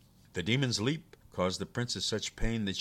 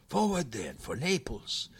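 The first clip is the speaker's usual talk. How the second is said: higher, louder rallying cry; resolute; 8 semitones higher, 5 dB louder than usual. Acted. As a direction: rouse himself to march on. Demon resolves to go.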